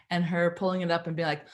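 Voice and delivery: normal talking voice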